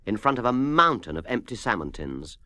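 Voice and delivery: ordinary delivery, nothing marked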